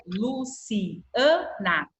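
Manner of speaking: "Luciana" slowly as Lu-ci-a-na; separated syllables. Shown as a demonstration. Teacher showing the A separates in "Luciana", syllable by syllable. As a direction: break "Luciana" apart, the A standing on its own. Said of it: "o A fica separado".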